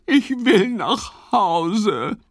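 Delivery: high, slow, plaintive little voice; homesick plea. Acted. Ole tells Fips he wants to go home, plaintive. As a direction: beg to go home, close to tears. Lost frog longs for home.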